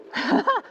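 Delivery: quick high surprised laugh; a sudden laugh at the near miss; short laugh. Real, not acted. Nearly missed the turn.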